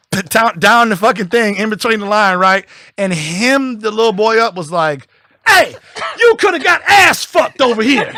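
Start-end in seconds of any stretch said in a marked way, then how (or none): 5.5-8.1 high, loud scolding yell with a laugh; scolding shout. Retold or imitated. Retelling a guard scolding a boy, high loud yell. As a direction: bark a warning at the kid.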